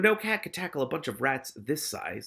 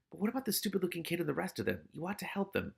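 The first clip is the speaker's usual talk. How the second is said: fast, soft inner nag; nagging conscience; 4 semitones higher, 7 dB quieter, faster than usual. Acted. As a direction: rush it like a guilty thought. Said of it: "a voice just like his own".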